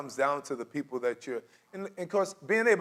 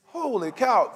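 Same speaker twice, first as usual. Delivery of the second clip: higher, louder, mock shock; a hammed-up "Holy cow"; mock amazement; 9 semitones higher, 7 dB louder, slower than usual. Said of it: "you're like".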